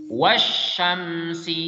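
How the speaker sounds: "Wasyamsi" drawn out slowly, alif lam silent, straight into the doubled sh; teacher shows the silent alif lam in "Wasyamsi"; correct tajwid reading. Shown as a demonstration. Teacher models Quran reading for pupils, slow and careful. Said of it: "alif lam ketemu dengan shin".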